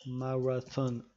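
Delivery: Marathon said the English way with an r like w, mawatonne; English-sounding Marathon, stress on the last syllable; mock English accent on Marathon. Host jokes with a fake English accent on Marathon. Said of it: "avec un super accent qui ne veut rien dire".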